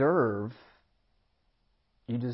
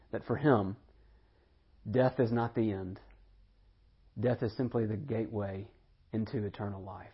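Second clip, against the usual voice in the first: normal talking voice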